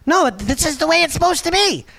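put-on high, insistent child's voice; whiny kid. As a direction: play the stubborn little kid. Kids insisting on their way.

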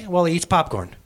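ordinary talk

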